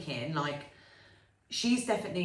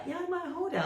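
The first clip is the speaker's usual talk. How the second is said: put-on child voice; 11 semitones higher, 4 dB quieter than usual. Voicing confident little daughter.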